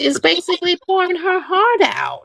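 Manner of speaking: high, squealing mock disbelief; mock outraged listener. Speaker mimics listeners reacting to Diamond, high mock outrage. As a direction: play a stunned fan asking why she talks like that. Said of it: "the first thing y'all will say is".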